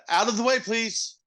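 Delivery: high, sweet polite siren plea; mock-polite emergency siren. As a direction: beg cars aside like a courteous siren.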